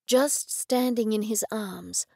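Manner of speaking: ordinary delivery, nothing marked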